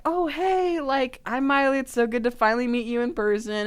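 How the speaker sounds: high, bubbly hello; imitating a polite, gushing introduction; cheery greeting. New roommate introducing herself in person, cheerily.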